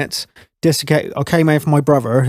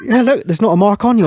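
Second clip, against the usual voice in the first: higher-pitched imitation of sceptical mates; doubting mates, imitated; 9 semitones higher, 3 dB louder than usual. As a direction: challenge the boast, pitched up. Mates doubting his boast, acted.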